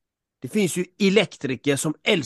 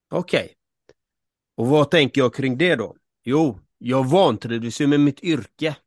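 ordinary delivery

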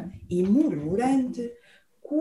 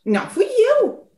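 high, squeaky mouse; nervous squeak; 9 semitones higher than usual. Acted. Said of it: "chiou o rato, nervoso".

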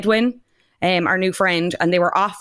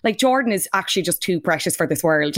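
normal talking voice

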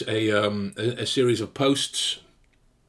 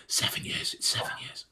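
unvoiced hushed realization; whispering; 8 dB quieter than usual. Real, not acted. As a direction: breathe it in awe. Whispered after the laugh.

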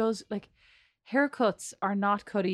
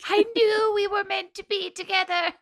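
mock lovesick swoon; 11 semitones higher, 6 dB louder than usual. Mock reunion with the stalked guy.

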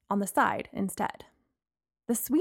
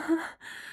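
weak, breathy laugh that sounds like a whimper; 6 semitones higher, 6 dB quieter than usual. A narrator's reading. Embarrassed girl on the floor, whimpering laugh. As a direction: try to laugh and fail. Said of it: "a laugh sounding more like a whimper".

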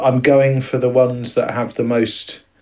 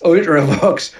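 put-on Geordie accent; 5 semitones higher than usual. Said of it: "in Geordie".

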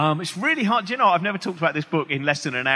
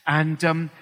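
usual speaking voice

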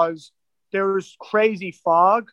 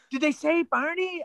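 high, soft quoted question; puzzled mock query; 9 semitones higher, 5 dB quieter than usual. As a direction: act out puzzled listeners, pitched well up and softer. Acting out crew hearing garbled radio.

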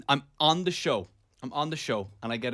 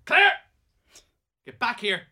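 mock angry summons, pitched up; retold anger; 9 semitones higher, 7 dB louder than usual. Ordering his partner back from the study, retold anger. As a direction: call her back sharply, acting out the row.

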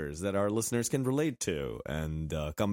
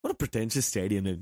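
ordinary talk, nothing marked

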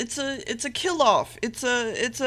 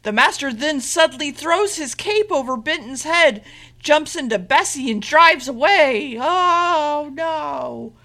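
hammed-up suspense, pitch raised, ending in drawn-out wail; mock drama; 4 semitones higher, 4 dB louder, slower than usual. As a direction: build to a fake-dismayed cry. Mock-dramatic retelling of escape.

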